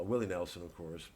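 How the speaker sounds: usual speaking voice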